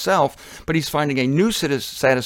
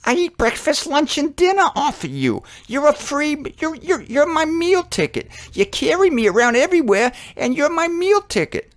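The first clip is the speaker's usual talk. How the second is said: very high, incredulous bird voice; high-pitched excited tick bird; 13 semitones higher than usual. Acted. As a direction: squeal it high as an astonished little bird. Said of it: "The tick bird's like".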